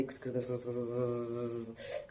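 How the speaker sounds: soft, hissed run of repeated swishes; whispery stirring effect; imitated stirring noise. Shown as a demonstration. Teacher showing how stirring sounds, soft hissed imitation. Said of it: "ऐसे बोलता नहीं है".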